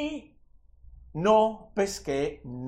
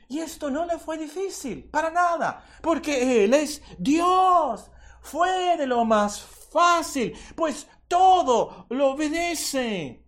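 high, building to loud drawn-out proclamation; impassioned preaching; 11 semitones higher, 5 dB louder than usual. Real, not acted. Preacher declares to congregation that God is sovereign, impassioned high preaching. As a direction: proclaim it with full force, stretching the key words.

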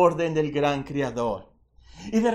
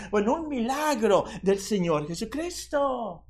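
normal talking voice

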